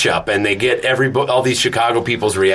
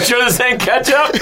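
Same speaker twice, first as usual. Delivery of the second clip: laughing quote; 10 semitones higher than usual. Retold or imitated. Repeating friend's quote, laughing.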